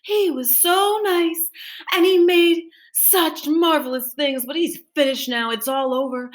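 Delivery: lightly voiced sorry townspeople; townsfolk lamenting. A narrator's reading. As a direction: sigh over poor Mister Wonka. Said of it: "everybody said".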